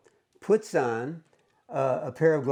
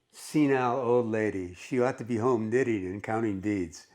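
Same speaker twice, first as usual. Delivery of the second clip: ordinary delivery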